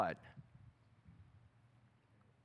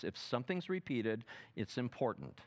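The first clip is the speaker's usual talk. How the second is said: softer, low and earnest; hushed emphasis; 5 semitones lower, 6 dB quieter than usual. Acted. Preacher to congregation, hushed and intent. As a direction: lean in and say it quietly.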